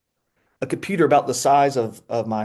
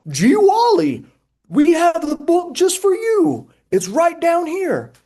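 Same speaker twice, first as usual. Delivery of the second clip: high, loud, slow goofy robot helper; puppet voice; 5 dB louder, slower than usual. Pretend. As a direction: cheer it out like a cartoon puppet. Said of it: "And Buff Nugget goes".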